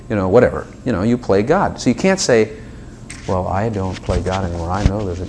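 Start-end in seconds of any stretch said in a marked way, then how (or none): 3.3-5.2 low, soft mock-pious voice. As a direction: imitate someone piously humble, low and subdued. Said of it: "you know, that's pious".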